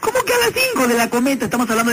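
high-pitched feigned outrage; mock indignant. Caller presses about the kite, mock indignant.

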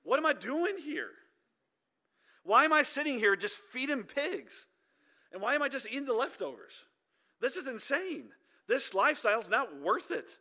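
a hammed-up grumble at his own life; fed-up prodigal son. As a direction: act out a young man sick of feeding pigs. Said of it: "He says".

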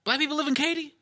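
high-pitched incredulous question; put-on high voice, rising in disbelief; homeboy's surprised question. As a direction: imitate a friend asking, pitch jumping way up. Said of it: "He goes".

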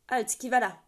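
loud hail to the gate above; calling out into the night. A narrator's reading. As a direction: call up to the sentry, loud and firm. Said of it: "appela-t-il".

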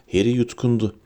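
plain reading voice

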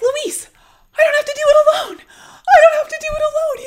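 high, loud, fast, near crying; tearful elated outburst. Retold or imitated. To Luis on finding him, high loud tearful joy. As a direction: cry it out to him in relief. He's like / cry heard.

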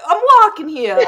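New Jersey accent. Shown as a demonstration. Jersey accent attempt, loud street-guy bark. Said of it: "that's the only phrase I know".

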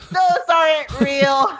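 slow, laughing while quoting; cracking up mid-quote. Retold or imitated. Quoting cartoon husband.

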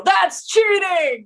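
high, loud, drawn-out shout; stretched-out yell of accusation; indignant yell. Acted. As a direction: yell it in outraged protest. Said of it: "Flint is gonna yell".

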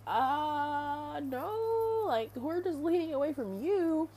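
high, drawn-out mock protest; retold defensive "as if" reply; incredulous denial.

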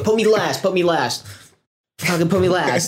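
mock panicked comedian; desperate plea. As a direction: beg them to push your set back. Comic going on stage late.

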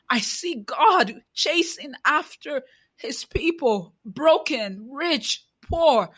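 preaching. Acted. Challenging Christians who do not see the oppressed, preaching. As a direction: preach it, pressing each question.

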